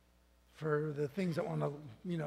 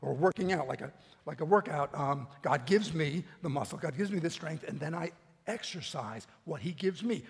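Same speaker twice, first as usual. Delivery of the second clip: normal talking voice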